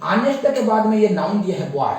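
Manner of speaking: ordinary talk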